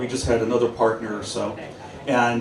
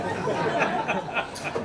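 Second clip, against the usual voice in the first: breathy laugh; 7 semitones higher, 7 dB quieter than usual. Real, not acted.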